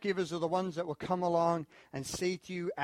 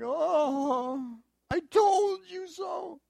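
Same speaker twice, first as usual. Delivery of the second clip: very high, loud, drawn-out wail; mock exasperated cry; 14 semitones higher, 7 dB louder, much slower than usual. Pastor to the student who ignored his warning, mock exasperated. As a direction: stretch it into a hammed-up shout.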